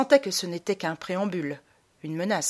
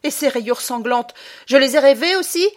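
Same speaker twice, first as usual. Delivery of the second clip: loud, higher demand; angry challenge; 7 semitones higher, 9 dB louder than usual. Acted. Boy challenging mother, angry. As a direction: throw it at her.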